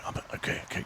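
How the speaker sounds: breathless whisper to himself; whispered nervous self-talk; whispered inner panic. Retold or imitated. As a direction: whisper it like you're bracing for a catch. Panicked inner thought while snatching.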